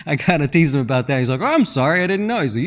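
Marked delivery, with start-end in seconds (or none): 1.4-2.4 higher, flustered apology; mock-flustered host; apologetic neighbor. Host caught pouring the cheap bottle, higher and flustered.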